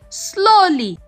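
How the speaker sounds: ordinary delivery, nothing marked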